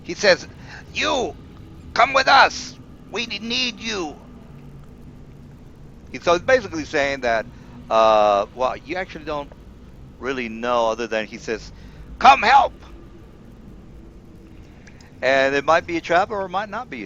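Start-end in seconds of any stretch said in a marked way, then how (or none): 0.9-4.2 NPC voice. Acted. GM voicing NPC plea. 12.2-12.8 urgent call. Acted. GM voicing NPC plea.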